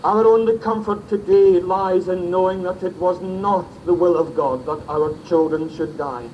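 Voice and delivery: ordinary delivery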